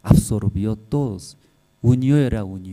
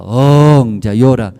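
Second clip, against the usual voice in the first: normal talking voice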